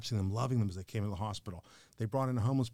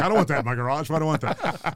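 loud, raised voice of comic protest; mock griping; 5 semitones higher, 7 dB louder than usual. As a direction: imitate men grumbling in exaggerated objection. Imitates men griping about garages.

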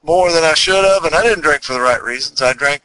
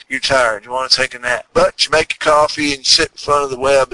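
ordinary talk

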